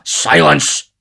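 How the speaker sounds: loud, commanding shout; imperious beadle's order; stern. A narrator's reading. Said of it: "cried Mister Bumble sternly".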